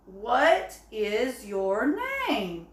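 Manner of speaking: slow, clear question with a falling end; asking a question. Shown as a demonstration. Teacher modelling a question for the class, slow and clear. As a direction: read it slowly as a model question. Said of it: "when you ask a question".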